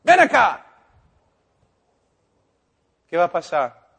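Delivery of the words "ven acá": llamada fuerte y aguda a la tumba; grito imitado. Retold or imitated. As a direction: grita hacia la tumba como el hermano Ernesto. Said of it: "hermano Ernesto grita". (grito fuerte a Lázaro muerto)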